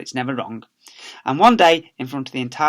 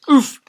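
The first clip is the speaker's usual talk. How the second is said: a high, loud knocked-over oof; comic grunt; winded oof; 12 semitones higher, 9 dB louder than usual. Acted. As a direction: get knocked flat with a grunt. Children knocked out of way.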